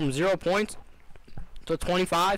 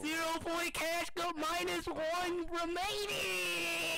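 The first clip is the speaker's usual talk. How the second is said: mock announcer voice; 10 dB quieter, much slower than usual.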